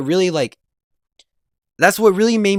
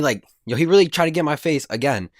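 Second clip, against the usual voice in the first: ordinary delivery, nothing marked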